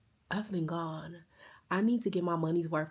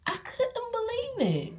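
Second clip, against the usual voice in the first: high-pitched disbelief; 3 dB quieter, slower than usual. Retold or imitated. First take, very high.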